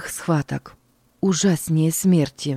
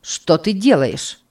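Berni's question; 3 dB louder, slower than usual. A narrator's reading. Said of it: "спросила Берни".

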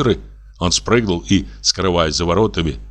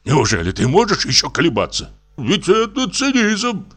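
put-on old man's raised, pleading voice; old investigator; 9 semitones higher than usual. A narrator's reading. Drunk investigator begging doctor to go, pleading. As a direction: press the doctor hard, voice climbing.

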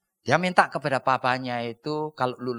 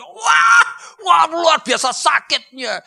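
loud high cry of pain; boy forced to walk again, crying out loud; pained outcry; 12 dB louder, slower than usual. Acted. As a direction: shout through the pain. Pain while learning to walk.

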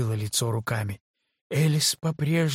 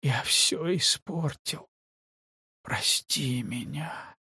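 soft, slow tearful apology; whisper-weep an apology; crying softly; 5 dB quieter, slower than usual. Acted. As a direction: Elis apologising to Kate through tears. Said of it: "Не переставая плакать".

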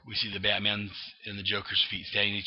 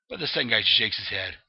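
plain reading voice

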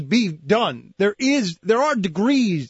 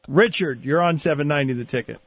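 usual speaking voice

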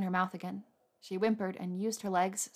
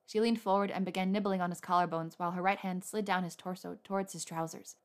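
plain reading voice

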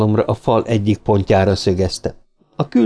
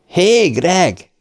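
high, merry call-out; acted bellow, pitched high; cheerful bellow; 7 semitones higher than usual. A narrator's reading. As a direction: holler happily to a friend far off. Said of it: "bömbölte".

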